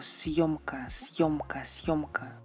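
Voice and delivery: "siomka" whispered clearly three times. Shown as a demonstration. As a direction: breathe out "siomka" with no voice. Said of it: "Por ejemplo".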